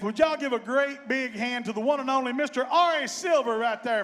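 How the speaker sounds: loud, rising stage introduction; announcer introduction. Real, not acted. Bandleader presenting the mandolin player to the audience, rousing announcer. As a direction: build it up for the crowd. Introducing band member to crowd.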